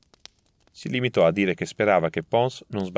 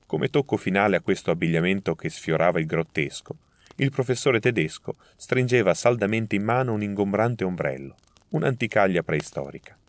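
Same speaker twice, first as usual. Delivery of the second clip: usual speaking voice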